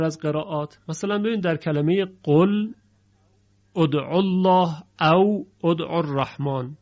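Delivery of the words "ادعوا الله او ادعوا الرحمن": ادعوا الله with lam silent and joined, او ادعوا الرحمن with u; recited Quran demo, qul joined straight into ادعوا; Hafs reading. Shown as a demonstration. Teacher recites the Hafs pronunciation for students.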